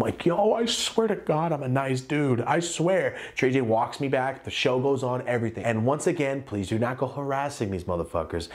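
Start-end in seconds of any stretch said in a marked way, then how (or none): 0.3-3.1 pleading innocence. Retold or imitated.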